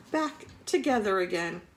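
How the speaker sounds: usual speaking voice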